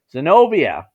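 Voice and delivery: loud call across the room. Acted. Kiba calling Zenobia to attack, loud shout. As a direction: call her name over the fight. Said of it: "Kiba called".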